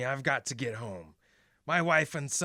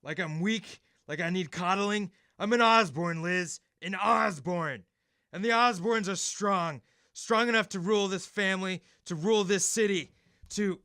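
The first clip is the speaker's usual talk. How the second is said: angry outburst; 6 semitones higher than usual. Acted. Husband rounds on his worried wife in anger. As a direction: bristle at being treated as weak. Harry snaps at Liz's coddling.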